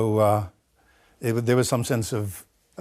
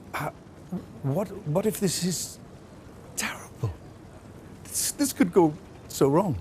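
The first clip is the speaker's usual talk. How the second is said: high, hushed, halting dread; re-enacted nervous near-whisper; anxious inner voice; 7 semitones higher, 5 dB quieter than usual. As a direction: voice a worried thought, soft and high. Voicing their own panicked thoughts.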